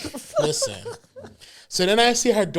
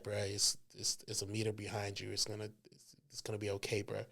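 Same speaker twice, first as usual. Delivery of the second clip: low, very soft murmur; hushed reassurance; 6 semitones lower, 12 dB quieter than usual. Retold or imitated. Best man calming the groom, hushed low reassurance. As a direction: talk him down privately, close to his ear. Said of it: "talking shit in my ear".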